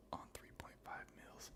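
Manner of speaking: barely audible whisper to oneself; whispered reading. Real, not acted. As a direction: whisper it while working out the meaning. Rereads puzzling comment to himself.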